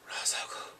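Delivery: whispered Ra's al Ghul; hushed realization of the name; awed whisper. Retold or imitated. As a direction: breathe the name in shock.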